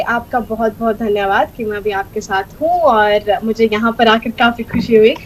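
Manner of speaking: fast, fluent Hindi. Shown as a demonstration. Guest demonstrating languages for the host, rapid Hindi. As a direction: thank the host quickly in Hindi. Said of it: "And Hindi".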